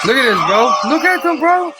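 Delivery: high, loud squeal of outrage; outraged exclaim. Real, not acted. Streamer reacting to cop getting doused, high outraged squeal. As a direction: shriek it in disbelief.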